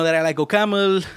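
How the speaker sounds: ordinary talk, nothing marked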